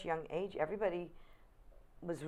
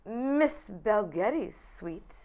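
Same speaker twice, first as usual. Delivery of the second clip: higher, louder, drawn-out mock-formal greeting; grand phone-answering voice; 5 semitones higher, 6 dB louder, much slower than usual. Young actress answering Barbara's hotel phone, mock-grand.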